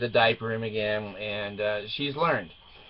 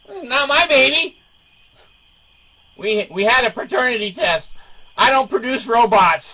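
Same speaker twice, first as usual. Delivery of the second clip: high, loud comic protest; mock indignant refusal; 10 semitones higher, 8 dB louder than usual. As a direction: protest loudly, disowning it for laughs. Dad retells refusing the baby.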